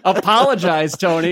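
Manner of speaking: loud, laughing mock order; half-laughing call to apologize; laughing demand. Real, not acted. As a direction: tease him through a laugh. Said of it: "playful demand".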